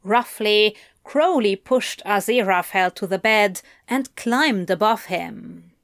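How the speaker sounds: usual speaking voice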